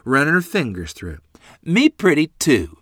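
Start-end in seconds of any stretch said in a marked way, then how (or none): 1.6-2.8 put-on high childlike echo of her own words; a small girl proudly repeating herself; little girl voice. Little sister at the mirror again, high child voice. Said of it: "Lorene said".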